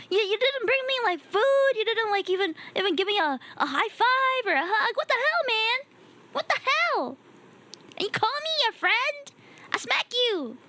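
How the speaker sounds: hammed-up, high-pitched scolding; mock indignation. Streamer threatening a neglectful friend, mock indignation. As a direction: pile up the grievances in playful outrage.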